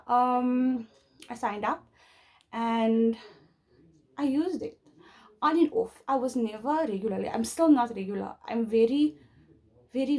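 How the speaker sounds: normal talking voice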